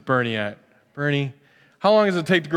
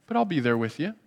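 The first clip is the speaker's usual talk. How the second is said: tender reassurance; 5 dB quieter, faster than usual. Pretend. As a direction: lean close and reassure. God's tender promise.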